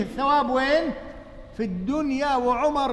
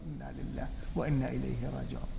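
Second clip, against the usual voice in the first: ordinary delivery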